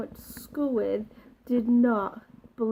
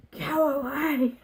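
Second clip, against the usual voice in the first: mock angry, pitched up and slow; 4 semitones higher, 3 dB louder than usual. Telling the girls outside the toilets to leave, mildly angry. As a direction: push them away, a touch of anger. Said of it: "I got angry and I was like".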